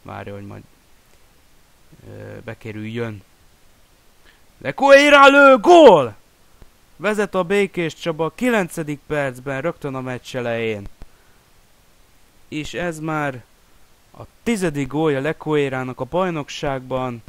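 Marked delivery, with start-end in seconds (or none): 4.6-6.2 huge goal scream, high and loud; excited Hungarian sports commentary. Real, not acted. Goal scored, commentator yells. 7.0-17.2 raised, louder after-goal commentary; excited Hungarian sports commentary. Real, not acted.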